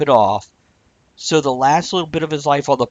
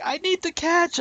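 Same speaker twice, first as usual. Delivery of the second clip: much higher, softer near-crying whine; tearful whine; 5 dB quieter than usual. Retold or imitated. Friend imitating tearful wife in the car, high weepy whine. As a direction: whine it like you're about to cry. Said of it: "she's getting worse".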